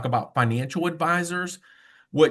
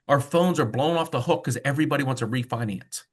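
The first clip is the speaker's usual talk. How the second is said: ordinary talk, nothing marked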